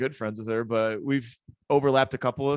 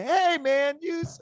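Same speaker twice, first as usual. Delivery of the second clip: put-on kid heckler; 12 semitones higher than usual. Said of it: "I heard this little kid say".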